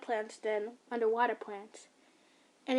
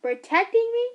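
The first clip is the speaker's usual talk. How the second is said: much louder, higher outraged echo; angry repeat; 10 semitones higher, 9 dB louder than usual. Acted. Son shouting his mother's excuse back at her. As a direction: hurl it back in disbelief.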